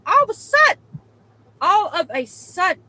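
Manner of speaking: high, loud suspense; dramatic build-up. Real, not acted. Telling viewers the bathroom story, high and loud.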